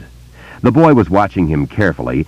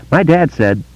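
put-on higher, eager boy's voice; young boy; 11 semitones higher than usual. A narrator's reading. Boy telling Bill about voices, in a higher boy's voice. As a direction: voice a wary small boy telling a secret.

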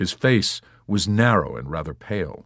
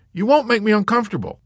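high, loud, quick reassurance; glowing affection; 5 dB louder, faster than usual. A narrator's reading. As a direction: gush it fast and eagerly. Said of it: "Yossarian glowed with affection".

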